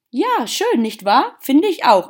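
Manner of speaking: put-on high, loud, pert little girl; cheeky girl. A narrator's reading. Cheeky girl answering the old gardener about her garden, pert and high. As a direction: pipe up as a pleased, cheeky child.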